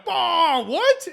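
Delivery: sudden high, loud mock gasp of shock; shocked what. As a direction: play the stunned investors, pitch jumping up. Investors shocked at hidden confession.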